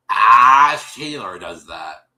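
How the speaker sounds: slow, loud "Ash Taylor does that"; stunned disbelief. Retold or imitated. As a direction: drag it out in mock shock. Retold disbelief at the news.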